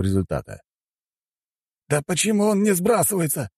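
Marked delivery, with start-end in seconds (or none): 1.9-3.5 high-pitched, frustrated snarl; mild angry growl. A narrator's reading. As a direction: demand in high-voiced irritation. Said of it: "прорычал председатель". (раздражённый рык высоким голосом)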